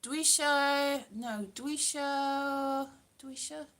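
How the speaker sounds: labored Welsh practice, "dwi isio" drawn out; careful Welsh. Shown as a demonstration. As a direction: sound out "dwi isio" slowly, struggling. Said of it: "I can't say it".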